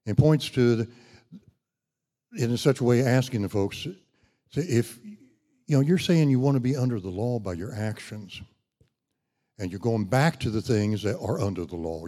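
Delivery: ordinary talk, nothing marked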